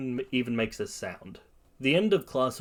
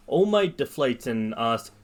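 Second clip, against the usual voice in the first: usual speaking voice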